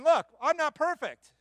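loud and high, "Look" hit hard; emphatic confession. Retold or imitated. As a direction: proclaim it to the whole church. Preacher voicing Paul's confession.

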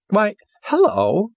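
high, slow feigned surprise greeting; mock surprise. House greets the intruder with high, slow mock surprise. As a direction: act as if caught off guard. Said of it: "as if surprised to see".

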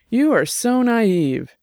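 high, drawn-out scorn; mildly derisive, stretching the words out slowly. Acted. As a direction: talk down to a naive friend. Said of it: "Jenny said derisively".

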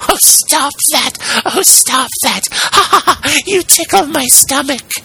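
very high, ticklish little squeal; giggling tiny voice. A narrator's reading. Piece of wood tickled by the plane, tiny giggling voice. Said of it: "This time it giggled as it spoke".

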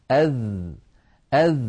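"أذ" with a held buzzing th as in "this"; tongue tip on teeth edges, sustain the end of "أذ"; dhal articulation. Shown as a demonstration. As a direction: demonstrate "أذ" in isolation, voiced and held. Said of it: "هذا هو حرف الذال".

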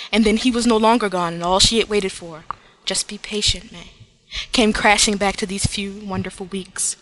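steady, measured fanfic reading; story narration. Acted. Host reads the honorable mention fic aloud, narrating. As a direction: read it like a heartfelt story.

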